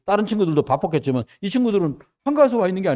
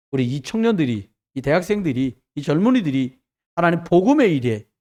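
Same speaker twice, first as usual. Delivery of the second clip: fervent prayer; 4 dB louder than usual. Real, not acted. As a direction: pray it phrase by phrase, rising to the close. Leads closing prayer.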